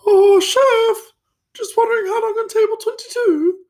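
very high-pitched posh falsetto; mock a posh accent in a squeaky-high voice. Front-of-house guy asking the chef about a table, posh high-pitched voice. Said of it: "a posh accent".

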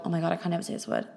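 fast, quiet exasperated aside; muttered frustration. Real, not acted. Frustrated over a hard word.

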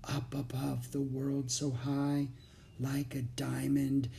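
slow, hushed stage whisper; softly slipping someone the answer, slowly. A narrator's reading. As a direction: whisper the line as a secret prompt. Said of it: "Jack whispered".

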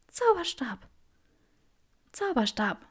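feeble, insistent demand; weak demanding. A narrator's reading. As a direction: demand it weakly but firmly. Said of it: "verlangte Granger".

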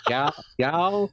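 "Y'all" tried out with a rising, questioning end; trying out y'all. Shown as a demonstration. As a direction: test the word "Y'all", unsure of the accent. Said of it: "how am I supposed to say it?".

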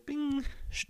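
soft, high bing, then a shush of the door; imitated chime and door. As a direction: voice the elevator doors closing.

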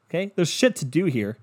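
usual speaking voice

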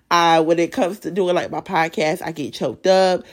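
usual speaking voice

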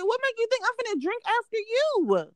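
very high indignant disbelief; high-pitched mock outrage at the offer; disgusted retort. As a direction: recoil from the very idea. Her own disgusted retort retold.

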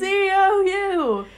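slow, loud chanted yell. Retold or imitated. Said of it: "and we were yelling".

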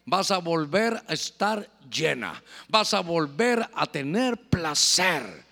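low, slow, measured assurance; solemn promise. Acted. God promising Noemí she will be full, solemn slow assurance.

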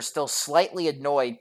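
usual speaking voice

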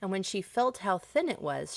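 plain reading voice

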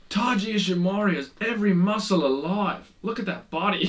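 high, put-on commentator voice; imitating Gino Gambino. Imitating a commentator gushing over a wrestler's body. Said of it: "He was like".

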